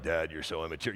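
very low, soft grown-son voice; deep, quiet teenage put-down; eighteen-year-old son. Retold or imitated. Eighteen-year-old son to his dad, low deadpan reproach. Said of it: "he's, he's eighteen".

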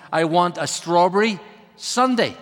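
usual speaking voice

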